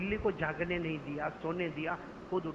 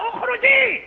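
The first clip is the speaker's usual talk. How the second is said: much higher, furious cry; angry shout; 13 dB louder, slower than usual. Acted. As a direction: hurl the word out in rage. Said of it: "Abu Huraira गुस्से में खड़े हुए". (ग़ुस्से में ऊँची चीख)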